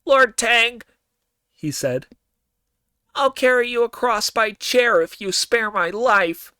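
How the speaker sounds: high, loud nose-pinched pleading; nasal voice. Acted. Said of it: "in a very nasal voice".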